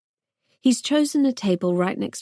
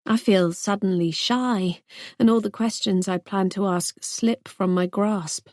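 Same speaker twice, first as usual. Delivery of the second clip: ordinary delivery, nothing marked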